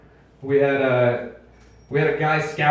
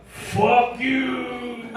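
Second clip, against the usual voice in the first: long-drawn, higher rock-singer cry; mock scream; 5 semitones higher than usual. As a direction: imitate a metal frontman screaming, stretching the words. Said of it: "you scream, "Fuck you," and faint".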